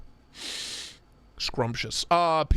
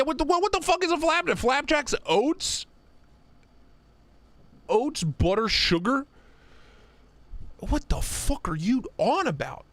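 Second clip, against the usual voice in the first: mock British outrage; 9 semitones higher than usual. Mock British rant over flapjacks.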